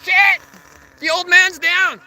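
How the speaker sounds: high, loud alarmed calling out; man down shout. Real, not acted. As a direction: yell it across the track. Buddy's truck crashes, calling out.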